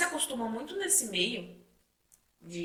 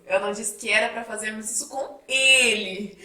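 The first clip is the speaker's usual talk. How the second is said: final "ele" punched louder; stress on ELE; 2 semitones higher, 6 dB louder than usual. Shown as a demonstration. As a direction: save the emphasis for the last word, "ele". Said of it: "como a entonação faz sentido".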